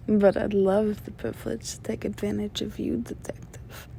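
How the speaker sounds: soft come-on fading to a whisper; hushed, teasing seduction; seductive. Acted. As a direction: let it trail into a breathy whisper. Seductive aside to the detective.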